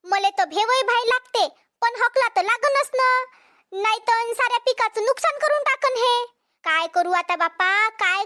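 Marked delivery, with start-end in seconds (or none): none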